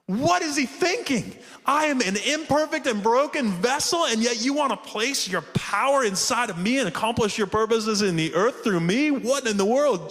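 high, emphatic bafflement; astonished disbelief. Acted. Imperfect believer questioning why God would use him, astonished. Imperfect vessel marvels at God's choice.

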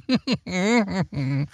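a cruel laugh, even harder; scornful laugh. A narrator's reading. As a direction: laugh at her with contempt. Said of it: "She laughed all the more".

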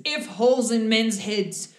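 slow, loud, broken-English threat; hammed-up menacing stranger, loud and slow; menacing figure. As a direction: loom over them and threaten slowly. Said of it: "the figure leans over Rain and the human and says".

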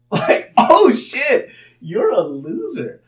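high, shouted mock epiphany; laughing, hammed-up shock at himself; loud self-realisation. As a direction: blurt it out, then stretch the verdict. Acts out realisation about himself.

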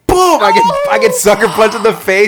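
loud, high-pitched blow-by-blow; animated outrage at the story's climax; worked-up storytelling. Retold or imitated. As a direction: burst out with the punch, keep it loud. Excited climax of fight story.

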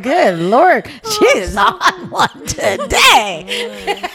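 mock exclamation rising into a high, slow squeal; laughing exasperation. Retelling her reaction to housemate's rant, exasperation breaking into laughter.

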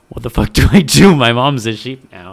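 a loud, hammed-up cry of distress; mock distress. Kid whose mom became a sheep cries out, in loud mock distress. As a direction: overplay the kid's panic, loud. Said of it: "genuinely distressed".

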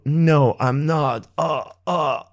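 mock-pained answer breaking into groans; sarcastic sick act with little groans; sarcastic fake dying. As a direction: pretend to be dying, hammed up. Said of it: "it's more of a sarcasm".

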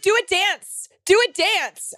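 high, taunting schoolgirl chant; mock high-pitched demand; popular girl. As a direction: imitate a popular girl goading a classmate. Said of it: "And she's like".

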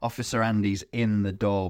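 normal talking voice

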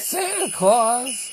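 slow, high, loud blurt; excited shout. Real, not acted. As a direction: blurt it out with glee. Blurted odd announcement.

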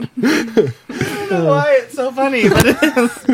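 high, giggling through the words; can't stop laughing. Real, not acted.

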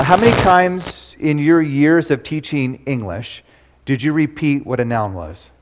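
usual speaking voice

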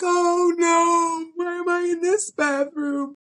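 high, slowed oh no; a hammed-up panic at the wrong room; panicked dismay. Hiding in the stall from the winners, high mock panic. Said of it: "I'm like".

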